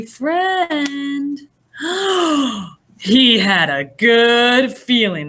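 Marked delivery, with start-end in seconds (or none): 1.8-2.7 a delighted, hopeful gasp. Acted. Reader gasps as Beagle spots a friend, delighted. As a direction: gasp like spotting something precious.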